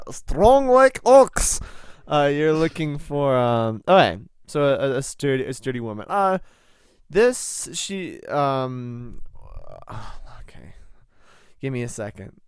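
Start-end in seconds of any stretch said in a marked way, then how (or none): 0.1-1.6 high, slow, hammed-up strongman. Jokey strongman voice. 10.4-10.7 weary sigh. Real, not acted. Exhausted sigh.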